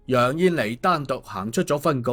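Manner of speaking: plain reading voice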